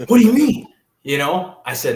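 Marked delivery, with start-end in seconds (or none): 0.1-0.7 loud, high, incredulous yell; retold angry outburst. Dad hearing his son's decommit, yelling in disbelief. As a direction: shout it like a father hearing his son decommitted. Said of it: "my dad was pissed".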